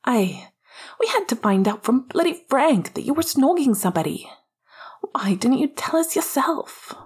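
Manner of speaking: high, hurt accusation; agitated high-voiced complaint, short of a shout. A narrator's reading. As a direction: demand an explanation, pitch pushed up. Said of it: "He had raised his voice".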